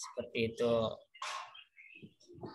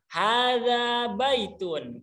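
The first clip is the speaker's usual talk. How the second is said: slow model phrase; 6 semitones higher, much slower than usual. Shown as a demonstration. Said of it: "coba langsung coba kita hafalkan".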